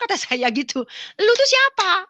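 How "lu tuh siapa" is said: high, loud repeated demand; retold loud challenge. Woman pressing the voice to identify itself, loud and high. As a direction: act out an exasperated yell at the unseen.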